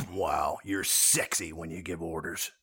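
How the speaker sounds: low, soft swooning voice; sultry aside. Acted. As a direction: purr it like a smitten admirer. Second personality swoons at the boss.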